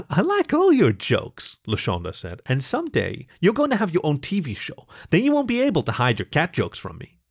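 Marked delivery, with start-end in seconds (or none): 0.1-1.6 high, slow, emphatic reassurance; voiced cat girlfriend. A narrator's reading. LaShonda encouraging Topher about his jokes, high character voice. 2.4-7.2 high, encouraging character voice; voiced cat girlfriend. A narrator's reading. LaShonda predicting Topher's TV show, high encouraging voice.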